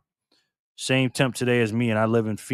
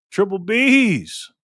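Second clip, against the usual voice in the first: very slow, stretched, higher and louder nickname; drawn-out hype call; 8 semitones higher, 5 dB louder, much slower than usual. Real, not acted. Streamer greeting a viewer by nickname, drawn-out ring-announcer call.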